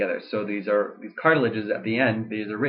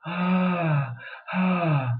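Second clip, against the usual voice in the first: breathy modal voice; 7 semitones higher than usual. Shown as a demonstration. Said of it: "I can make a breathy modal voice".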